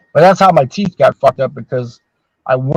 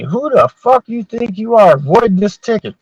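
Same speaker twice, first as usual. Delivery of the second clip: slow, higher demanding voice; angry officer; 7 semitones higher, slower than usual. Retold or imitated. Provost marshal confronting the MP about the ticket, mock angry and slow. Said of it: "They come up to me".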